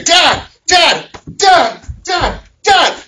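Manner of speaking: put-on kids yelling. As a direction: mimic kids hollering for Dad over and over. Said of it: "But then I hear".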